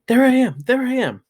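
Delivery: high, thrilled squeal of recognition; excited child. Acted. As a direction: point at the screen, beside yourself. Child sees herself on TV.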